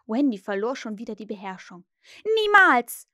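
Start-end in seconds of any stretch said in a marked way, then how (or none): 2.2-3.1 high, loud scream; screamed refusal. A narrator's reading. Wendy loses control on hearing the plan, screamed refusal. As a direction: cry out having lost all control. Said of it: "schrie sie".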